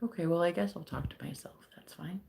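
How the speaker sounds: whispered resigned aside; wry whispered remark to no one. Real, not acted. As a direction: drop to a whisper, talking to yourself. No answer from chat.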